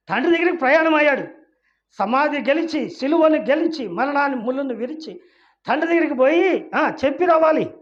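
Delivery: preacher rising to a loud rebuke; fiery preaching. Acted. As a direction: build the rebuke up to a shout. Preaching at full force.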